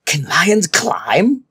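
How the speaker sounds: slow frightened whisper; gasped whisper. A narrator's reading. Child trapped by a lion, gasped whisper. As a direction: breathe it out in terror. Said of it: "gasped Shingle".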